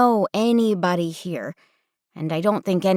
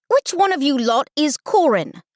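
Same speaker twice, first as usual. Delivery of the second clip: high, demanding British counselor; heavy British accent; 8 semitones higher, 4 dB louder than usual. Acted. Counselor asking the campers who Corin is, heavy British accent. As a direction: quiz the group in broad British English. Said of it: "she says in a heavy British accent".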